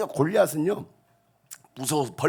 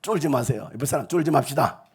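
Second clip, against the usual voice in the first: ordinary talk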